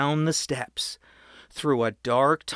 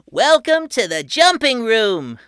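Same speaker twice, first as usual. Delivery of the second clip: put-on high, loud tour-guide kid; proud boy host; 9 semitones higher, 9 dB louder than usual. A narrator's reading. Patrick welcoming his family underground, high and proud. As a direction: announce it like a grand showman. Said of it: "Patrick cried".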